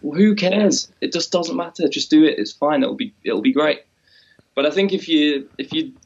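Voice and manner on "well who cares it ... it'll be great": high, breezy voice brushing every worry aside; mock carefree shrug. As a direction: toss it off lightly, it doesn't matter. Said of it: "It's like".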